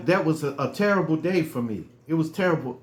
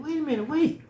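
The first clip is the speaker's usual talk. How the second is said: surprised stranger; 9 semitones higher, 3 dB quieter, faster than usual. Retold or imitated. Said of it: "They said".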